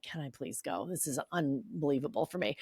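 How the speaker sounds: soft, low, meek request; imitated plea. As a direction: beg the boss quietly. Pleading with the boss, retold.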